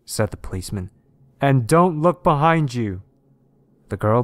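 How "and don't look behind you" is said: loud, slow, raised warning; higher, deliberate officer's command; policeman's warning. A narrator's reading. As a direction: call out each word firmly. Policeman calling warning to girl.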